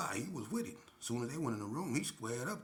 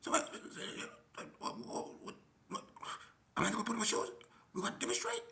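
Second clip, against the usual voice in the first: high, squeaky and groggy, much higher; faster than usual. Retold or imitated. Squeaky groggy voice of a fellow inmate complaining about the shower. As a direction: squeak it out like a groggy old con. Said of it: "groggy, squeaky kind of voice".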